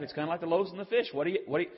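normal talking voice

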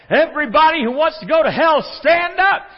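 full high-pitched preacher's shout; yelled. Retold or imitated. Preacher yelling to wake the sleeping man in church, shouted. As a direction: bellow it at the congregation, acting out the preacher. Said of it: "and he yelled".

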